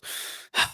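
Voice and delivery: heavy breath drawn before speaking; long audible breath, acted out; deep breath. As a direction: imitate a sergeant taking a deep breath. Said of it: "takes a deep breath".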